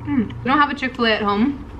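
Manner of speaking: eating between words; talking while chewing. Real, not acted. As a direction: mouth partly full of food. Seen 2: She holds a bitten nugget, chews, and bites again while talking.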